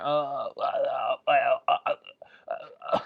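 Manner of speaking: nervous mock stammer trailing off. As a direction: imitate someone stuck for an answer. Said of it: "just a whole bunch of stammering".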